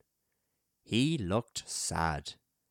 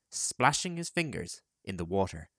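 ordinary delivery

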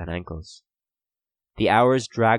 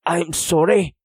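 high, louder apology; apology said aloud; 10 semitones higher, 5 dB louder than usual. A narrator's reading. Prisoner blames himself before cellmates, apology said aloud. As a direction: speak the apology out loud, pitched up. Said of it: "he said aloud".

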